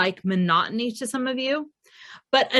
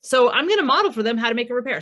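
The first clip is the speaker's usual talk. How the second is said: ordinary talk, nothing marked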